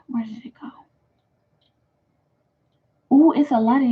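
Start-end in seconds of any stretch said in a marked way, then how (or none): 0.1-0.7 whisper. Real, not acted. Searching for comment, whispered.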